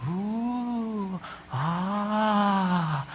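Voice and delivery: soft, hushed oohs and ahs; impressed oohs. Retold or imitated. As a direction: breathe out in quiet admiration. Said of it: "they'd be going".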